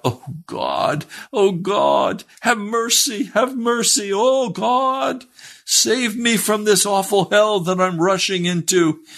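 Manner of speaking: high, wailed prayer for mercy, imitated; desperate outburst. Sinner praying by the wagon, acted desperate high cry. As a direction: climb into a sustained high cry to God. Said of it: "he burst out".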